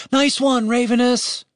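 high-pitched calling out; shouted praise. A narrator's reading. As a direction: call after a friend who is leaving. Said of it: "Julian shouted after him".

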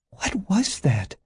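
high-voiced woman, puzzled; Janet's voice. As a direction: ask it slowly in a high woman's voice.

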